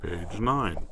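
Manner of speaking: ordinary delivery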